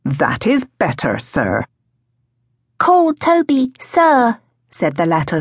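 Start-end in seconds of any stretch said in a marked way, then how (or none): 0.0-1.6 low-pitched man's voice; middle-aged gardener, dropping low on the last word; gardener William. A narrator's reading. 2.8-4.4 put-on high small-child voice; high childish correction; little boy Toby. A narrator's reading.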